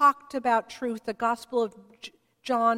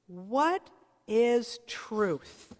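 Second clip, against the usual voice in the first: slow, weighty question; much slower than usual. Retold or imitated. Pilate's famous question, drawn out.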